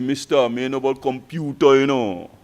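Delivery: low Kitishan twang, stretched out. Retold or imitated. Student protesting to his lecturer about computers, in Kitishan twang. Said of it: "in his local Kitishan twang".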